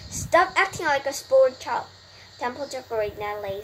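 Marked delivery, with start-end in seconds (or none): 0.1-1.9 loud, raised scolding; scolding yell. A narrator's reading. Wilbur scolds the lazy rat, raised-voice yell. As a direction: scold loudly, pitched up. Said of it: "screamed Wilbur".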